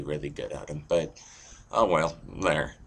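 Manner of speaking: ordinary talk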